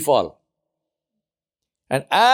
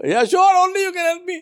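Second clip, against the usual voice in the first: very high, strained begging voice; cling to him and plead in panic; frantic pleading; faster than usual. Acted. Man pleading while hanging on rope.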